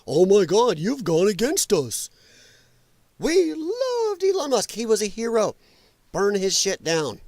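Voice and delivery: high, whiny mock of a leftist, slowed down; mocking lefty. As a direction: sneer out the leftist's outrage. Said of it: "this is what lefties believe".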